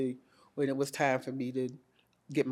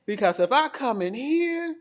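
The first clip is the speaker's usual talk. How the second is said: retold indignation, pitched way up; high loud protest; 10 semitones higher, 8 dB louder than usual. Patient balking at being treated in the centers, high loud protest. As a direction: wail it loudly, hamming up the objection.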